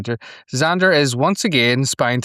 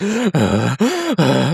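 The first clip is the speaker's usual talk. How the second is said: crying gasp; 5 semitones higher, 4 dB louder than usual. Shown as a demonstration. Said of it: "one of those criers".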